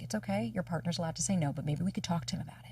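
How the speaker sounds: put-on soft, hushed soothing to her inner child; calm yourself like a gentle parent; quiet reassurance trailing to a whisper; soothing self-talk. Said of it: "let my little know".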